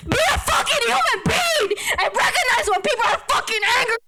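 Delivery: real tears, yelling high through sobs; high sobbing rant with broken laughs; crying angry shout. Real, not acted. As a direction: voice cracking with grief and rage at the officers. Breaks down yelling at police.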